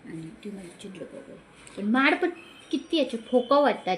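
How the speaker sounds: ordinary talk, nothing marked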